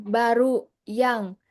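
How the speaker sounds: drawn out word by word; slow teaching demo; slow and deliberate. Shown as a demonstration. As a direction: spell out the reversed order slowly.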